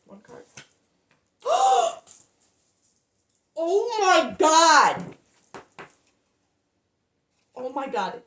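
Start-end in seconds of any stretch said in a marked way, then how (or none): 1.4-2.0 sharp high gasp of shock. Real, not acted. Reader flips the card and gasps in shock. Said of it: "I'm emotional right now". 3.6-8.2 slow, loud, drawn-out oh my God; stunned. Real, not acted. Tarot reader stunned by the card, slow loud disbelief. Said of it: "I'm emotional right now".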